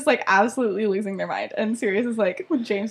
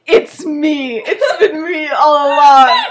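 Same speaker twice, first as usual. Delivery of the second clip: loud, higher, drawn-out declaration; hammed-up confession through laughter; mock-dramatic reveal; 5 semitones higher, 10 dB louder, slower than usual. Other beater steps out of the crowd, melodramatic. Staged reveal of secret lover.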